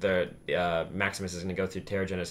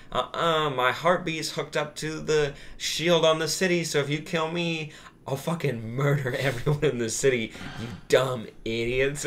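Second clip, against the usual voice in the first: slow, sing-out threat pitched up, half amused; mock villain inflection; 4 semitones higher, slower than usual. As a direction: play a gloating villain, drawn out and higher. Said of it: "in that inflection".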